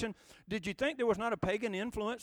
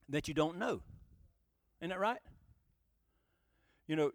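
usual speaking voice

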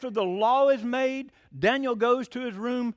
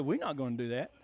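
low, soft quoted answer; quiet refusal; 7 semitones lower, 7 dB quieter than usual. Retold or imitated. Acted refusal answering government order.

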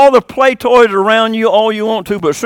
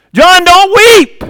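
urgent call; 11 semitones higher, 8 dB louder, much slower than usual. Acted. Elder calls out to weeping John.

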